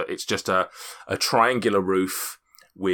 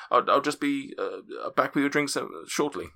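higher, apologetic host fumbling for words; flustered restaurant host; 6 semitones higher than usual. Acted. Host apologising to diners for the overbooking, flustered and high. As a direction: play the embarrassed host caught off guard.

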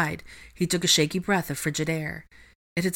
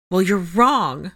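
slow, loud, angry contradiction; forceful, heated retort; voice starting to rise; 3 semitones higher, 7 dB louder, much slower than usual. A narrator's reading. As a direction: push back hard, anger rising. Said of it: "Her voice started to rise".

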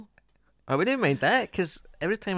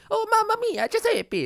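high-pitched, louder mock Italian; caricature Italian accent; 4 dB louder than usual. Shown as a demonstration. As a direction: squeal it as a cartoon Italian. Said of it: "If you're doing it right, if you're like".

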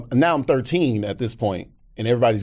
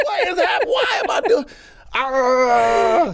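very high hammed-up tearful cry; mock wailing; slower than usual. Man retelling his tears on the bus, high mock wail. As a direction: wail it in bewildered, exaggerated emotion.

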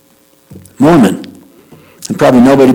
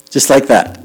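normal talking voice

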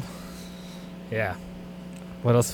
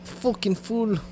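mock Conor McGregor; 6 semitones higher, 8 dB quieter, slower than usual. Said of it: "sound more like fucking Conor McGregor".

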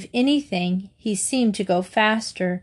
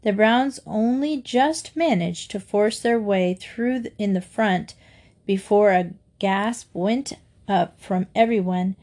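usual speaking voice

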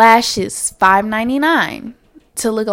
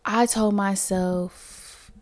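ordinary talk

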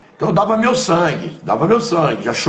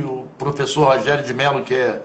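ordinary talk, nothing marked

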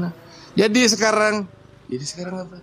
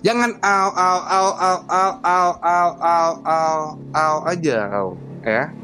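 higher, repeated mocking au; mock his little oh over and over; mocking scold; 3 semitones higher, slower than usual. Father mocks the young man's reply, higher mocking voice.

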